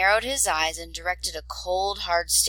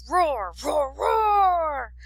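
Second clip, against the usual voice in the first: rising, higher and higher roars; child's dragon roar; 9 semitones higher, 4 dB louder, much slower than usual. Acted. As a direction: wriggle free while roaring playfully. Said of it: "he shouted, struggling".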